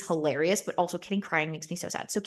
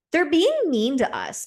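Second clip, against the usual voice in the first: higher, louder imitation of complaining; mock whining; 8 semitones higher, 5 dB louder than usual.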